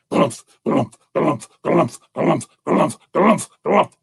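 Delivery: rhythmic heavy thumping noises; imitated lumbering gallop; bear footfalls. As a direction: thud out evenly spaced bear steps. Imitating bear's heavy running feet.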